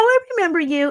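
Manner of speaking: high-pitched retold "oh, it's you"; imitated eager recognition. Acted recognition on the phone.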